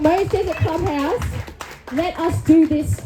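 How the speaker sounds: normal talking voice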